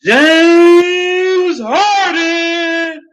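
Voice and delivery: long drawn-out stadium yell; hype shout. Real, not acted. Vlogger opening his video with a huge drawn-out shout. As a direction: bellow the name like an arena announcer.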